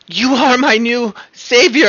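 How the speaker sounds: high, breathless imp gushing; adoring imp. Acted. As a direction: gush like a tiny creature in love. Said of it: "imp gasps, clings to neck".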